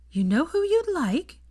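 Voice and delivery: high, slow, bright question. A narrator's reading. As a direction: ask it with sudden cheer. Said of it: "said Hermione brightly".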